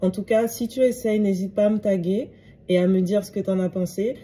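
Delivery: ordinary talk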